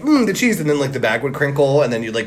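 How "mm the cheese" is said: savoring cheese. Pretend. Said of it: "so you'd be like".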